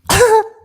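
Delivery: loud, high burst of laughing; burst of laughter. Real, not acted. Host cracks up after the politician's clip, loud laughing. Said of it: "you shouldn't laugh".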